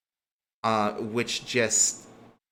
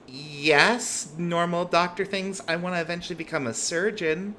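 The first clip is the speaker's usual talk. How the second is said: higher-pitched, pointed comeback; skeptical eyebrow raise; 7 semitones higher than usual. Acted. Med student answers about her studies, mildly affronted. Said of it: "raises an eyebrow and goes".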